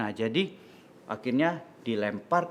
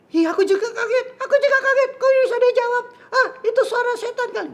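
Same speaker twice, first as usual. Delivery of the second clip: loud, very high squeaky puppet; alarmed high-pitched puppet; startled little puppet; 8 dB louder, faster than usual. Acted. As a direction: squeal in shock, tiny high voice.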